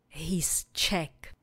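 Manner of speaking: whisper. Shown as a demonstration. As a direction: breathe "He's Czech" quietly for repetition. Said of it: "Opakujte po mně". (šeptem „He's Czech“)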